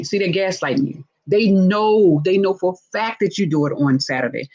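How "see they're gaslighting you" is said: ordinary talk, nothing marked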